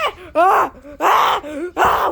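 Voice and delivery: loud high screams of fright; terrified shrieks. Acted. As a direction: scream at the monster. Screaming at the monster.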